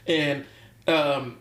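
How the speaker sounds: usual speaking voice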